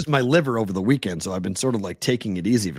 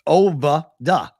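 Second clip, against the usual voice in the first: Ovada drawled slow and loud with no R, Boston style; 5 semitones higher, 5 dB louder, slower than usual. Shown as a demonstration. Co-host teased over his Boston accent, drawn-out Ovada. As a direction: stretch out Ovada, drop the R like Boston. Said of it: "Can't drop the Boston".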